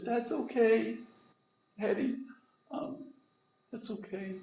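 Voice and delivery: put-on a dying child forgiving her dad, fading out; little girl fading. Dying daughter forgives, fading away.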